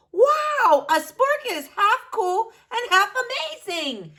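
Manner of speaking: high, loud awestruck gush; cartoon bean blown away; amazed. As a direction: wow at the coolest thing ever.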